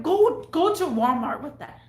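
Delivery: heated retold command to leave; angry dismissal. Ordering customer out of store.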